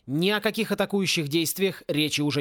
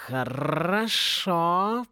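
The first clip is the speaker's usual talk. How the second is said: very slow feline purr with rolled r; much slower than usual. A narrator's reading. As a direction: purr it out, stretching each sound. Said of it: "промурлыкал голос".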